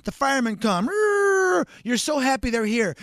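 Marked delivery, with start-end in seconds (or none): 0.9-1.7 mock siren. Fire truck arriving.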